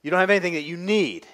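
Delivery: ordinary talk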